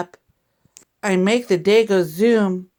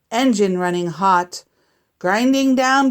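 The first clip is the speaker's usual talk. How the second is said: ordinary delivery, nothing marked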